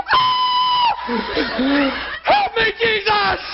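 high, slow, laughing cry for help; mock panicked yell. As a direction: ham up a terrified believer. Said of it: "mocking the panic".

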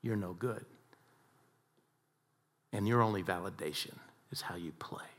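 a soft, hushed whisper; whispered lie. Retold or imitated. Enemy voice whispering lies to the golfer, hushed. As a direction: hiss the lie quietly like a tempter. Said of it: "the enemy told him".

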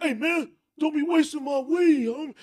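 sharp, shouted snap; angry snap. Retold or imitated. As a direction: snap at him, high and loud. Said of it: "He snapped".

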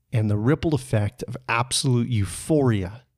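ordinary delivery, nothing marked

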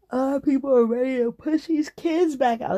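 talking through a yawn; stretched mid-yawn words. Real, not acted. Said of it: "Excuse my yawning".

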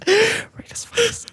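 drawn-out, hushed whisper. Real, not acted. Podcaster plugging the show to listeners, whisper. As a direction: whisper the plug to the end. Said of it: "I'm gonna whisper the rest".

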